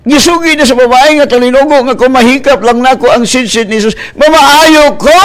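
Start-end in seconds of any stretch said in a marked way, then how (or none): 2.0-5.2 loud proclamation. Acted. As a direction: proclaim her hope to the crowd. Woman's believing words, proclaimed.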